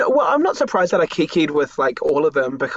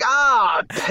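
higher, mock-deflated groan; a hammed-up letdown; mock disappointment; 7 semitones higher than usual. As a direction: let the hope collapse, playfully. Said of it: "I was like".

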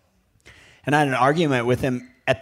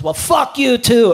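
much higher, louder, slow sing-song fuck you too; mock shouted comeback; 10 semitones higher, 9 dB louder, slower than usual. Dad answering the rival's insult, loud and slow. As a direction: fling it back, drawn out and loud. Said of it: "my dad goes".

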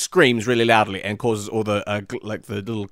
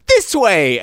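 excited shout; 10 semitones higher, 9 dB louder than usual. Acted. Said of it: "they're like".

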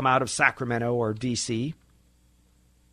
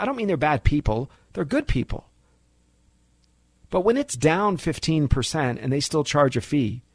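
ordinary delivery

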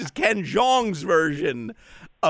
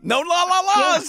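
excited; 12 semitones higher, 3 dB louder than usual. Real, not acted. Correcting sung la la.

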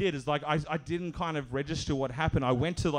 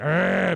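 loud answering "arr"; mock retaliatory snarl; imitated growl; 3 semitones higher, 7 dB louder than usual. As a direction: growl straight back at him. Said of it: "my homeless man went".

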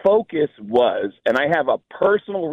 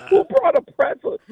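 usual speaking voice

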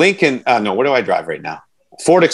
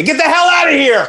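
high-pitched, hammed-up yell; play-acted dressing-room tirade; mock angry shout; 4 dB louder than usual. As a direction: holler at an intruder in mock outrage. Acts out yelling at custodian.

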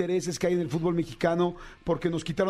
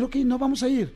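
raised, higher-pitched decision; defiant declaration; 7 semitones higher, 3 dB louder than usual. Retold or imitated. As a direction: announce it firmly, fed up. Fans declaring boycott.

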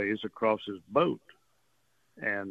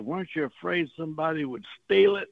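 neighbor's country voice, pitched higher, lightly put on; hint of a country twang, raised pitch; country talking voice; 7 semitones higher, 3 dB louder than usual. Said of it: "he's got this country talking voice".

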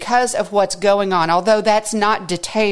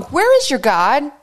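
higher and louder mocking jeer; taunting question; 7 semitones higher, 4 dB louder than usual. Preacher voicing the psalmist's taunting enemies, higher and louder jeer. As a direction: throw the line back like a scoffer. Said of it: "they say to me all day long".

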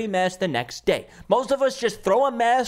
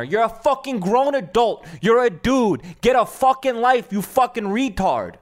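louder, furious insults; angry outburst; 3 dB louder than usual. Real, not acted. Telling off the grown man mobbing the woman, furious. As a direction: let the Staten Island temper out. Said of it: "Sorry, my Staten Island's coming out".

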